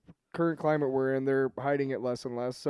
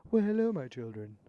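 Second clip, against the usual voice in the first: gentle New Testament God; 5 dB quieter than usual. Acted. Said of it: "If I'm New Testament".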